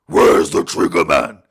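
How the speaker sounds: low, loud, slow rasping growl. Retold or imitated. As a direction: growl it like Bale's Batman.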